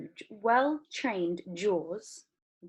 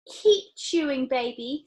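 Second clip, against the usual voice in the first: loud, slow encouragement; eager father; 6 semitones higher, 5 dB louder, slower than usual. A narrator's reading. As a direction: egg her on. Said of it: "said Mister Beauregard".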